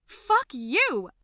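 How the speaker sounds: high, loud angry curse; furious outburst. Acted. Girl so mad at her cousin, furious. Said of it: "I got so mad".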